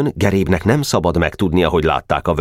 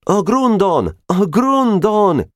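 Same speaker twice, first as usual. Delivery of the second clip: higher, slow, mock-cheering "A Grundon!"; cheering shout; much slower than usual. Boys cheering the Grund as they run home, shouting. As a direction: cry it out like a rallying call. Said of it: "kiáltotta a többi is".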